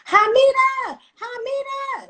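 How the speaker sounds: calling a name across the street, high and loud; screaming a name. Retold or imitated. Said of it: "I hear someone screaming".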